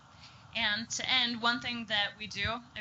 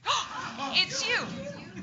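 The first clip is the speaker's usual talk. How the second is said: much higher, excited cry of surprise; surprised greeting; 11 semitones higher, 4 dB louder, much slower than usual. Shown as a demonstration. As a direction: act thrilled that a visitor has come. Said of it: "kind of a surprised look".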